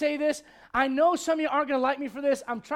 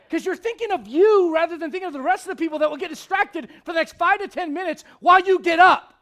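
ordinary talk

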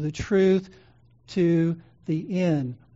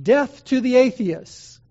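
slow, louder, higher ringing call; proclaimed slogan; 6 semitones higher, 7 dB louder, slower than usual. Retold or imitated. Preacher voicing the proconsul's demanded slogan, slow and loud. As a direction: declaim it as the crowd's slogan. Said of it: "he's ordered by the proconsul to say".

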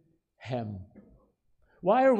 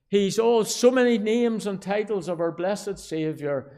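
raised, louder preacher's proclamation; fervent preaching; 5 semitones higher, 5 dB louder than usual. Real, not acted. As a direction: proclaim each title with rising fervor. Preaches at full force.

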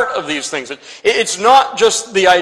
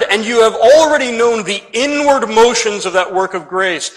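ordinary talk, nothing marked

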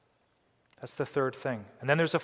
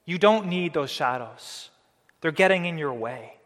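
Paul's firm reply; 5 semitones higher, 10 dB louder than usual. Retold or imitated. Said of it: "And Paul was saying".

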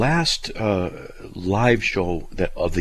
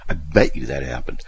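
ordinary delivery

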